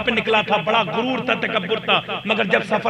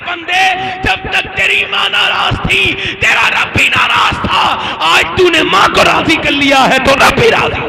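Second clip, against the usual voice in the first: voice from the unseen; 8 semitones higher, 9 dB louder than usual. Acted. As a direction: proclaim it like a voice from heaven. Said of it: "غیبی سے آواز آئی". (غیبی آواز، دھیمی اور بلند، لَے میں)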